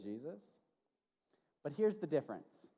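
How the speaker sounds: ordinary talk, nothing marked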